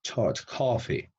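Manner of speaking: "Tot, coffee" slow, with a rounded British "o" like "awe"; slow British demo of "coffee" with a rounded o; British open o. Shown as a demonstration. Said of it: "yo puedo pronunciar".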